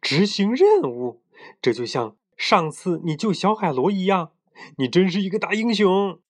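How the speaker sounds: high-pitched, thrilled admiration; very excited. A narrator's reading. Little brother hails his brother as a hero, very excited. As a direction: gush with excitement at your big brother. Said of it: "兴奋极了".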